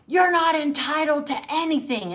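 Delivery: high, loud mock telling-off; imitated scolding coach. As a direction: scold like a harsh dance teacher. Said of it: "but the woman was like".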